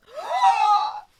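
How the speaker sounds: loud high delighted gasp; sharp shrieking gasp of delight. Real, not acted. Sees stenciled edges.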